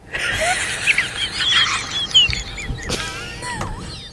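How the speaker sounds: high, helpless wheezing laughter. Real, not acted. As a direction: die laughing, out of breath. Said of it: "old man wheezing, dying laughs".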